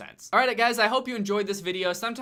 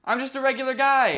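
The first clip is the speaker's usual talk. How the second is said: high, raised voice of hasty reassurance; nervous pleading; 8 semitones higher, 5 dB louder than usual. Acted. Zombie insisting to a survivor he's harmless, nervous pleading. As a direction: talk down someone holding a knife.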